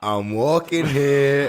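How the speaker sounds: loud, slow New York "I'm walking here"; New York accent. Shown as a demonstration. Friends comparing accents, slow loud New York accent. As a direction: bellow it like a New York cabbie. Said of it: "The New York accent".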